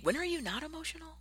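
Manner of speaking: a high, teasing mock question; lightly voiced teasing listener. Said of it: "some of you ... may think".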